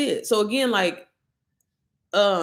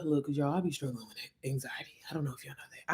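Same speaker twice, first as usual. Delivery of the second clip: confiding whisper; hushed confession to viewers; 5 semitones lower, 13 dB quieter than usual. Real, not acted. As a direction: lean in and whisper a secret. Confiding aside about anxiety.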